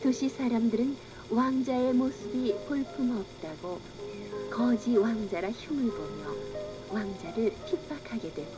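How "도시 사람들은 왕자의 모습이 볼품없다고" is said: plain reading voice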